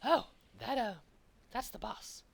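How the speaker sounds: very high, quick little cat creature voice; high squeaky cat voice. Acted. GM voicing the little cat guide pointing out the boss, squeaky high. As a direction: squeak it out like a tiny excitable cat. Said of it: "he goes".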